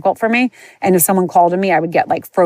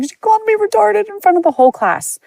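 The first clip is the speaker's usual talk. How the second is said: high, tearful wail, far higher pitched; crying. Retold or imitated. Girl crying to the vice principal about her teacher, tearful high wail. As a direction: squeal it out like a sobbing kid. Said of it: "I ran to him hysterical crying".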